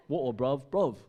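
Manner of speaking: loud, hammed-up English slang; bad English accent. Preacher retrying the local slang, loud bad English accent. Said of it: "No, I can't do English".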